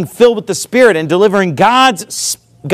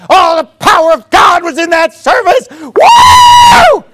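high, loud hammed-up ecstatic shout; mock worshipper; 11 semitones higher, 6 dB louder, slower than usual. Worshipper after a wild service, high mock ecstatic shout. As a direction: shout it like a carried-away worshipper. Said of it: "and it's like".

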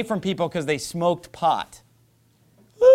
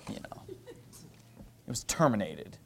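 much lower and softer, slow, scornful; mock euphemism; 7 semitones lower, 13 dB quieter, much slower than usual. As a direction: murmur it slowly like a sneering quote. Mocking the euphemism, dropped low.

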